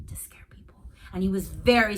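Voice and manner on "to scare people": whispering. Acted. Ghost story whisper.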